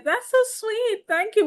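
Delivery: high sweet mimic of the mom; mimicking mom. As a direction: parrot the mom's sugary thanks. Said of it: "when the mom said".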